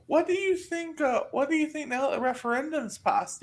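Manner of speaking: put-on high, creaky old Irish man parody; old Irishman voice. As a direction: play a ninety-year-old Irishman, way up high. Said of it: "Irishman YouTube channel where he's supposed to parody ninety-year-old Irishman".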